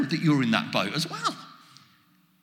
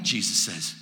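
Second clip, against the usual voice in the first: ordinary delivery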